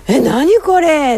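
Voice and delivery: high, slow puzzled gasp; surprised. Retold or imitated. Retold discovery of talking bear.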